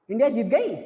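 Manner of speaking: गई rising sharply; surprised-sounding question rise on गई; asking intonation. Shown as a demonstration. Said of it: "उनसे तो यही तो पूछेंगे".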